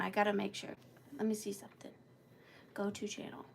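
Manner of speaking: whispered note to herself. Real, not acted. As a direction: whisper it under your breath. Muttering to herself while checking.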